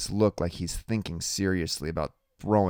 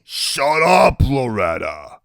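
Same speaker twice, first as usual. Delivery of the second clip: loud, drawn-out snap; irritated Iggy; 5 semitones higher, 9 dB louder, much slower than usual. Acted. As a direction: shut her down with a loud burst. Iggy cuts Loretta off.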